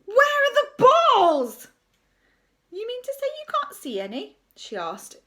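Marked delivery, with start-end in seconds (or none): 0.1-1.7 slow, high, very loud demand; desperate bellow. A narrator's reading. Teacher demands to see balls. 2.7-4.4 high-pitched innocent question; mock innocence. A narrator's reading. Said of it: "mock innocently".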